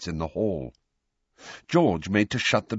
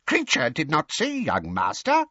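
house elf voice; 8 semitones higher than usual. A narrator's reading. Elf's fake innocence.

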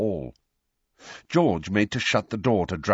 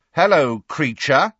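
loud, pointed greeting; 8 semitones higher, 8 dB louder than usual. A narrator's reading. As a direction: call it out loud and sharp. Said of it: "said Fred very loudly".

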